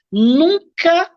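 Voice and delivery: NUNCA dito alto e agudo; ênfase no nunca. Shown as a demonstration. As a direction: bata forte no NUNCA. Ênfase em regra do mediador. (ênfase forte em "Nunca")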